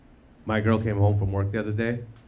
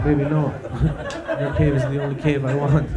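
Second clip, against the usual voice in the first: laughing pleading; 4 semitones higher, 3 dB louder than usual. Retold or imitated. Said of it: "And you're like".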